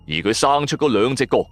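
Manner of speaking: usual speaking voice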